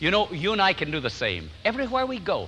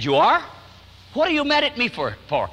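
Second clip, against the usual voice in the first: mock surprise; 7 semitones higher, 3 dB louder than usual. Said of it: "She said".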